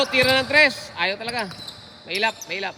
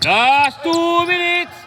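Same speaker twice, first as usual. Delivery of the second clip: stretched, loud, high arena call; slow rising announcer shout; drawn-out announcer call; 5 semitones higher, 4 dB louder, much slower than usual. Real, not acted. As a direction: boom it out slow to the crowd. Announcer calling final minutes.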